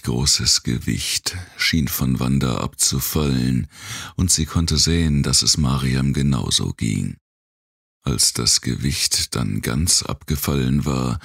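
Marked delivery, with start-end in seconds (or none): none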